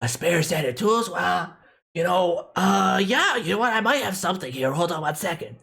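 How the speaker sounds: put-on high nasal kid voice; eager little kid shopkeeper; nasally kid voice. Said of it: "My nasally kid voice is my best voice".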